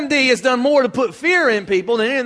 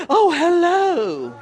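sudden sweet phone voice; 3 semitones higher than usual. Acted. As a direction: gush to the caller as if nothing's wrong. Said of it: "all of a sudden the phone rings".

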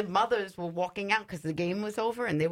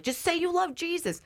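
high, pressing plea; urgent plea; 12 semitones higher than usual. Retold or imitated. Speaker pleading with a possessed woman, high and urgent. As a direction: beg her to say it. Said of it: "I'm like".

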